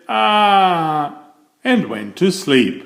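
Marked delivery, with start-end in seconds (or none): 0.1-1.2 put-on contented baby sigh. A narrator's reading. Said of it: "The baby said".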